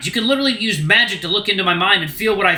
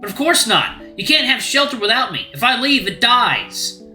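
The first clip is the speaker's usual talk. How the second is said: raised, bitter and hurt; angry rant; 4 semitones higher than usual. Acted. Luke to Rune about Teak's bullying, raised and bitter. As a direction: pour out every grievance at once.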